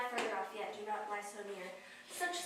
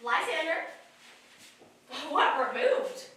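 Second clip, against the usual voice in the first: ordinary delivery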